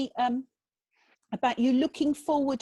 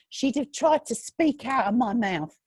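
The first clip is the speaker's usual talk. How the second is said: ordinary delivery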